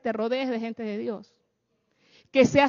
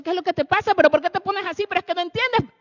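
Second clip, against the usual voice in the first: higher, louder, faster exasperated quarrel; raising your voice; 6 semitones higher, 5 dB louder, faster than usual. Retold or imitated. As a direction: voice climbing in a heated argument. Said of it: "comienzas a subir el tono de voz". (voz alzada en una discusión de pareja)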